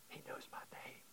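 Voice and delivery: hushed whisper of awe. Shown as a demonstration. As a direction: breathe it out as a secret. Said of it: "whispered repeat of child's words".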